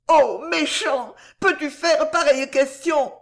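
high, loud protest; girl's fond reproach. A narrator's reading. Girl answers her lover's doubt, high fond reproach. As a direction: scold him lovingly in a high voice.

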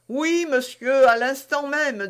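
high-pitched put-upon valet; harried servant. A narrator's reading. Harried servant answers his master.

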